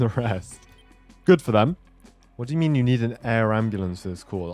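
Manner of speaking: ordinary talk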